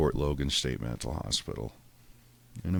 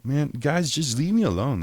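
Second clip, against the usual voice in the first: whiny plea; 11 semitones higher, 7 dB louder than usual. Retold or imitated. Whining at cops.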